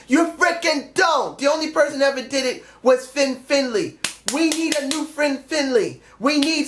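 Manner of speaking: real fan rant, shouted; emphatic shout. Real, not acted. Heated wrestling rant.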